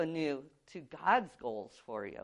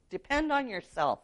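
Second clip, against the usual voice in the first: higher, mock-confident voicing of the world's advice; worldly motto; 6 semitones higher, 3 dB quieter than usual. Preacher voicing the world's motto, mock-confident. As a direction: quote the world's slogan a little up. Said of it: "That's what the world preaches".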